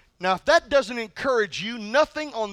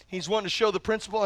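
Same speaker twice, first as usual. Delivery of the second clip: ordinary delivery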